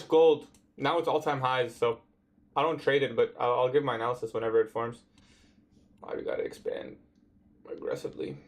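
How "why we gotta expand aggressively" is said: a puzzled private whisper at the screen; whispered aside. Real, not acted. Trader whispering to himself at the chart, puzzled whisper. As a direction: whisper it under your breath.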